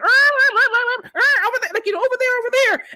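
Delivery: very high, squawking chicken voice; imitated tattling chickens. Chickens telling on the squirrels, high squawky imitation. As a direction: squawk like a hen pointing out intruders. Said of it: "I hear the chickens going".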